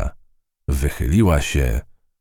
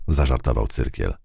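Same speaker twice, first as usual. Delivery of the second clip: plain reading voice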